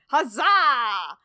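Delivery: loud hammed-up huzzah; hearty cheer. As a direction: cheer it like a jolly knight. Reading exclamation in email.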